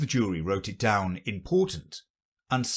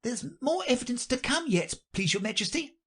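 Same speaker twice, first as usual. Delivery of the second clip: very high, deferential voice; high-pitched plea to the king. Acted. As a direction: interrupt politely as an anxious courtier rabbit.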